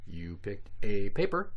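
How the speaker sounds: ordinary talk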